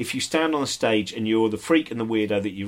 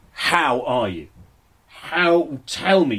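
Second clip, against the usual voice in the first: "How are you" drawn out with feeling, then "tell me" pitched up and louder; imitating insistent, heartfelt asking; earnest, probing question; 2 semitones higher, 4 dB louder, much slower than usual. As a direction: lean hard on "How" as if really wanting an answer. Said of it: "They wanna know how you are".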